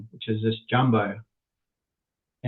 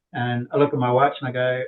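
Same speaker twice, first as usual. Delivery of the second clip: ordinary talk, nothing marked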